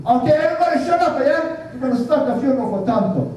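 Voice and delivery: high, fast, bossy call to the room; Samoan guy calling order. Retold or imitated. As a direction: hush the crowd fast and high. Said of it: "So he said".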